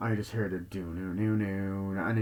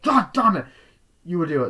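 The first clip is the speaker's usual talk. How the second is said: much higher and louder, a real blow-up; furious shout; 8 semitones higher, 9 dB louder than usual. Retold or imitated. Boyfriend shouting at the producer, furious high yell. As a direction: bellow it out in rage. Boyfriend exploding in retold fight.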